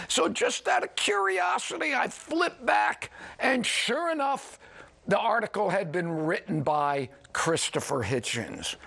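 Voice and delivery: ordinary talk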